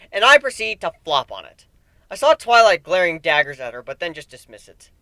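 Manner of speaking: plain reading voice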